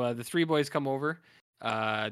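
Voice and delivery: normal talking voice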